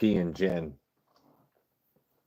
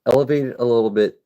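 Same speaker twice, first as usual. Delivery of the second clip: higher, louder and faster, lifted up; elevated voice; 5 semitones higher, 6 dB louder, faster than usual. Shown as a demonstration. Streamer showing chat his raised, brighter talking voice. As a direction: pitch it up to sound friendlier. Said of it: "I talk like this".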